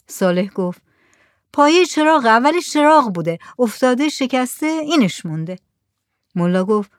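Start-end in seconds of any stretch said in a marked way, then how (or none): 1.5-5.6 lightly voiced Saleh, higher, explaining; patient villager. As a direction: explain the broken lamp patiently.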